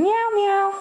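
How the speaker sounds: high, loud meowing like a cat; nervous cat voice. Acted. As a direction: meow up high to amuse the baby. Meowing after complaint.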